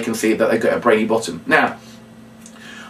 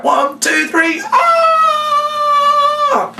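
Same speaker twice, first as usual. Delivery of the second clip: count-in and shout; much slower than usual. Shown as a demonstration. Entertainer leading the girls' shout, yelling. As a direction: whip up the girls.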